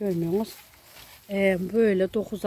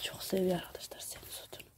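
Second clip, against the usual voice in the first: whispered aside; 13 dB quieter than usual. Real, not acted. Telling viewers about Medine, in a whisper. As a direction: whisper it so Medine won't hear.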